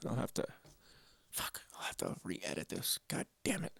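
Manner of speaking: very soft, whispered cursing; frustrated whisper. Real, not acted. Vlogger realizing the recording needs re-editing, real whispered frustration. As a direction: curse under your breath, barely voiced.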